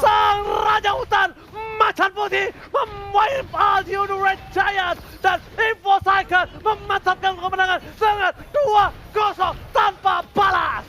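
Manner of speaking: screaming the game-winning fight; shouting play-by-play. Acted. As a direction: yell every kill as it lands. Victory call.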